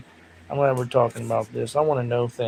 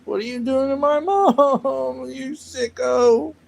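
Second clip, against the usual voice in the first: much higher, louder, laughing yell; child yelling; 10 semitones higher, 5 dB louder than usual. Retold or imitated. Host retelling walking in on parents, laughing high yell. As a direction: shriek at the parents as a shocked kid. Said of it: "the worst memory".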